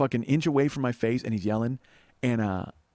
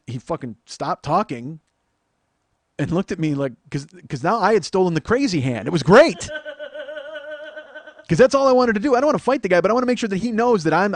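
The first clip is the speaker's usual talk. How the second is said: ordinary talk, nothing marked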